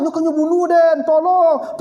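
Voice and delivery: high mock cry for help, kau nyo bunuh den; retold screaming. Old parent crying out at the child bathing her, mock scream. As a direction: shriek like a confused old parent. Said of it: "dia teriak-teriak".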